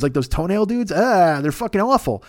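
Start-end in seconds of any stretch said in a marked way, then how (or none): none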